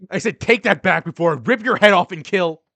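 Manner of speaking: loud, high enraged threat; furious roar. Acted. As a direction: explode with murderous rage. Boy explodes in threatening rage.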